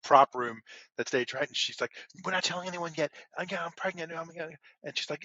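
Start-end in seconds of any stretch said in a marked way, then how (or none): 2.2-4.6 flustered. Retold or imitated. Pregnant wife's secret is out.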